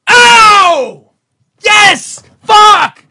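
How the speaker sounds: loud high joyous yells; erupting cheer. Retold or imitated. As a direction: burst out cheering at the game. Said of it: "you've ever had that".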